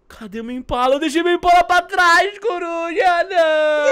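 high, loud wail of dismay; dismayed shout. Real, not acted. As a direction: cry out over a forgotten thing. Realises he left mount behind. (grito agudo de desespero)

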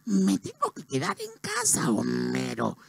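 soft, strained cartoon wife voice; Marge. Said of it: "Homero con Marge". (esposa de dibujo animado, voz suave y forzada)

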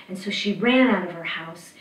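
ordinary delivery, nothing marked

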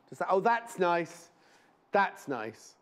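usual speaking voice

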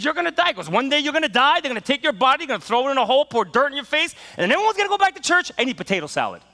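high, loud preaching; preaching at full force. Retold or imitated. Pastor preaching to the students at full force. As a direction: preach it hard at the kids. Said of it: "So he says".

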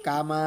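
"কামা" with a very long final vowel; short "কা", endlessly drawn "মা"; three-alif elongation. Shown as a demonstration. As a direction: hold the "মা" of "কামা" on one note. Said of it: "তিন আলিফ পরিমাণ আপনাকে টানতে হবে".

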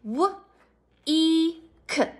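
W-E-K spelt out letter by letter, quietly; sounding out letters. Shown as a demonstration. Teacher spelling W-E-K letter by letter for the class. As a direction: spell out W-E-K slowly, one letter at a time. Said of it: "Break it".

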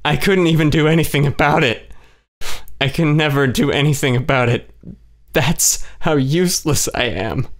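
weeping self-blame; crying. A narrator's reading. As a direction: sob it out, then sink into defeat. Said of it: "She kept crying".

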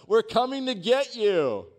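high, loud rescuer's call; yelling down the shaft. Retold or imitated. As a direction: shout it down to someone trapped below. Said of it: "they yell down the shaft".

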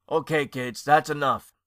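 put-on mildly gruff grown-up telling kids off; a hint of a gruff shopkeeper. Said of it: "a rather gruff voice said".

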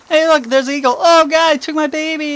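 high, loud hammed-up panic over a snatched baby; mock panicked parent. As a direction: shriek it like a parent watching an eagle grab their child. Acted parent losing baby to eagle.

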